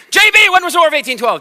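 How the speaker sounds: loud, high, fast teacher's demand; startling call-out. Acted. Teacher calling on an inattentive pupil, startling shout. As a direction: pounce on the pupil by name, loudly. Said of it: "is she maybe gonna get startled?".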